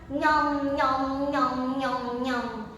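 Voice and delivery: even "nyom" chomps, sinking and fading; munch rhythmically through each syllable; munching noises. Acted. Storyteller imitates the giant eating the big fruit, munching.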